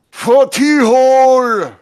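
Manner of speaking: long, drawn-out high yell; battle yell. Acted. Game master voices Daggerface facing a wolf, long loud yell.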